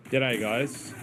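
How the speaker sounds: a light put-on Australian accent, overdone; fake Australian accent. Gamer greets the bounty hunters in a mock Australian accent. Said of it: "Australian accent done by a non-Australian".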